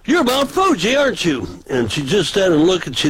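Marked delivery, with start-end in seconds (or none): 0.0-1.5 high, gleeful calling out of the name; mock-excited high accusation; excited recognition. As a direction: crow it out like you caught her. Teasing recognition of wrestler.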